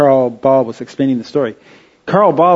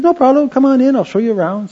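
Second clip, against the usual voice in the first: mock eager welcome; 11 semitones higher than usual. Young museum guide welcomes visitors.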